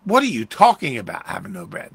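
loud exasperated opening, dropping away at the end; exasperated rebuke. Acted. Preacher showing how Jesus said it, exasperated. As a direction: hit the question hard, then let it fall. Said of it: "That's the way he said that".

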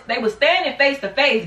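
ordinary talk

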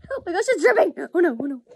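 loud, high-pitched yelp of dismay; sudden real fluster, voice jumping up; startled alarm. Real, not acted. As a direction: cry out as something starts dripping. Mask dripping, sudden alarm.

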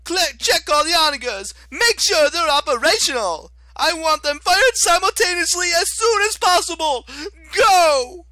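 high shrieking commander; Octavian screaming orders. Acted. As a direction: yell orders like a frantic tyrant.

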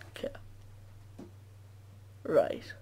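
whispered under breath. Real, not acted. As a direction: breathe it out unvoiced. Unvoiced single word.

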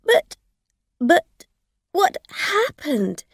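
high, loud stammering; flustered. A narrator's reading. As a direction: stumble over the words in panic. Driver stammering in alarm.

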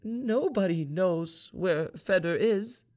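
high, slow, hollow lament; hollow-voiced young woman, slow and despairing. A narrator's reading. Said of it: "murmured Vaninka in a hollow voice".

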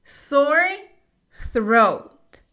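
Sore rising then throat with tongue out; slow model of Sore throat; careful pronunciation. Shown as a demonstration. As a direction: split Sore and throat, blow the th. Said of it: "sacas la lengua y soplas".

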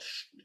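a long voiceless sch hiss; voiceless hiss demo. Shown as a demonstration. Teacher shows the class a voiceless hiss. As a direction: hiss out like air from a tyre. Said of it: "Stimmloser, gut artikulierter S-Laut".

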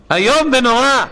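preacher shouting in protest; outcry. Real, not acted. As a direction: cry out against it with all your strength. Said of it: "צריך לצעוק על זה בכל הכוח".

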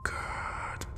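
slow whispered praise; lulling whisper into a trance; hushed approval. Acted. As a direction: breathe it out very softly. Victim falls into trance.

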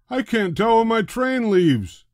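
high, loud complaint; annoyed passenger. A narrator's reading. Passenger upset about the scrambled board. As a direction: grumble loudly about the board.

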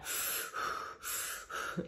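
long breathy puffs, in and out; mimed heavy breathing on a mouthpiece; imitated gas-and-air puffing. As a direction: puff away as if on gas and air. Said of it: "with the gas in there, just like".

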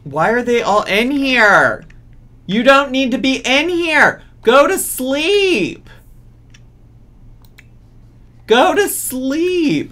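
fed-up player screaming at her Sims; exasperated yelling. Real, not acted. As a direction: bellow at them to go to bed.

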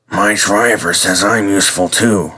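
put-on subdued old engine character voice; reply as a modest old traction engine; lightly voiced, wistful engine; Trevor the old engine. A narrator's reading.

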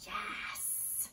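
breathy whispered yes. Real, not acted. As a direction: whisper it softly. Unvoiced aside.

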